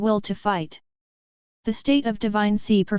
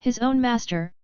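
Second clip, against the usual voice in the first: ordinary delivery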